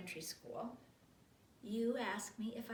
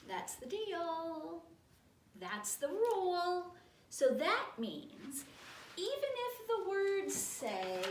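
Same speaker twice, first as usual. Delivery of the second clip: very slow, stretched-out, higher reading; story narration; 7 semitones higher, 5 dB louder, much slower than usual. Acted. Reader sets out the book's rule, slow emphatic read-aloud. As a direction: lay down the rule, dragging each word.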